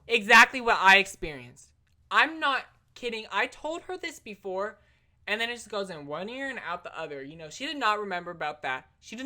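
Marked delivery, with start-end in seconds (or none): 0.1-4.8 sudden loud exclamation, pitch jumping up; excited outburst. Real, not acted. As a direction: blurt it out, thrilled it matches. Excited outburst about mom's matching story.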